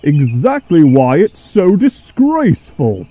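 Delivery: loud, high, drawn-out bellow; indignant bellow. A narrator's reading. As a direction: bellow slowly in outrage. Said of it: "bellowed Gordon".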